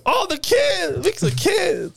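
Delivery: high, squealing mock alarm; silly high-pitched distress; Jar Jar Binks. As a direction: cry out like a panicked cartoon sidekick. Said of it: "and Jar Jar goes".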